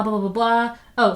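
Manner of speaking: ordinary delivery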